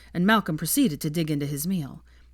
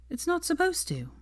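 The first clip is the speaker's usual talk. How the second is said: coolly; 12 semitones higher, 3 dB quieter than usual. A narrator's reading. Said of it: "Hermione told him coolly".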